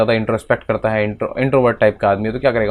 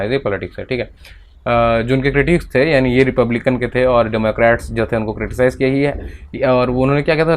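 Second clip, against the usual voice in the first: ordinary talk, nothing marked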